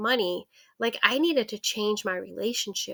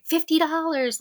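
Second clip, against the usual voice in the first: put-on higher, proud little girl; higher proud answer; proud child; 7 semitones higher, 4 dB louder than usual.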